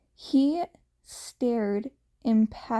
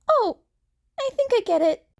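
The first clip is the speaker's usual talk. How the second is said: put-on realising boy; 12 semitones higher, 5 dB louder, much faster than usual. A narrator's reading. Izuku realises.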